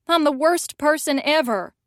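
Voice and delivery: ordinary delivery, nothing marked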